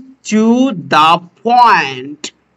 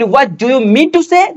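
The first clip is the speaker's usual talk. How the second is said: slow question; 5 semitones higher, much slower than usual. Retold or imitated. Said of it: "যদি বলি".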